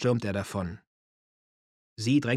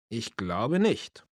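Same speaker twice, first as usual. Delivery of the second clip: slow, thoughtful answer; seriously pondering; much slower than usual. A narrator's reading. Man answering about a girlfriend, seriously pondering. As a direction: draw it out as if thinking it over. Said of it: "musste ernsthaft nachdenken".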